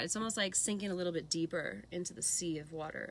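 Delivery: usual speaking voice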